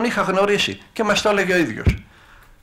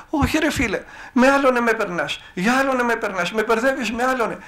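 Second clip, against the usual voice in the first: frightened pleading; 7 semitones higher than usual. Retold or imitated. Thin man pleading with possessed tall man.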